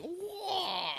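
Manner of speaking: wah squawked high; high mock bird cry. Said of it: "he's the".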